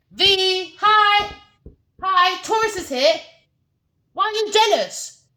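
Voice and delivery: high, loud mocking girly voice; sing-song taunt as the rival woman; mock Taurus woman.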